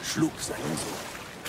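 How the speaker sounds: hushed. A narrator's reading. Grave story moment.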